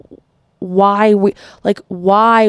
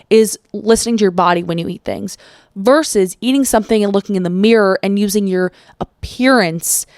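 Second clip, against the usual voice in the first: ordinary talk, nothing marked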